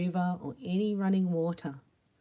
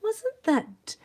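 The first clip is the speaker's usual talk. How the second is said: jealous gossip; 5 semitones higher, much slower than usual. Retold or imitated. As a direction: sneer like a jealous neighbour. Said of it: "they'll be like".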